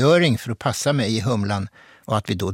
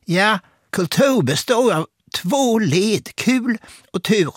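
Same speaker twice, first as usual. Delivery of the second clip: slow, high-pitched quoted answer; Hasse Alfredson; 10 semitones higher, slower than usual. Retold or imitated. Comedian answering about moving to Dramaten, high and slow impression. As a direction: put on a much higher voice, drawn out. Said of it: "så svarade han".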